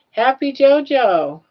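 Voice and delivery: high, loud, drawn-out hello; slow, bright call of a viewer's name; cheery greeting. Real, not acted. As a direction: sing out the name to greet her.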